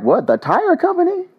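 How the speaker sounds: high disbelieving squeak; shocked he mistook it for a tire company; surprised what. Retold or imitated. Reading city shout-outs, baffled by the name Goodyear. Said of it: "I was like".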